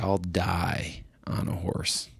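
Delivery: slow, hushed, breath-heavy line; raspy quote. Retold or imitated. Retelling a tough horseman's vow, slow and hushed. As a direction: draw it out, barely voiced. Quoting a tough old rider.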